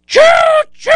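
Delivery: high shouted choo-choo; train whistle. Acted.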